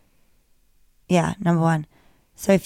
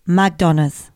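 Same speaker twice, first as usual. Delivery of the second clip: "McDonald's" low, L dropped, Nigerian impression; mock Nigerian accent; 4 semitones lower than usual. Shown as a demonstration. Host demonstrating accent hack, mock Nigerian accent. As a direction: put on a lower Nigerian accent. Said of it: "this is the Nigerian".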